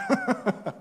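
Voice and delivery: sudden high amused laugh. Real, not acted. Laughs at how his friends avoided him, high laugh.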